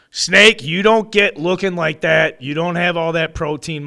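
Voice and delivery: hyping the co-host, loud and excited; hyped up. Real, not acted. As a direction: pump him up with a big shout-out. Hyping co-host's physique.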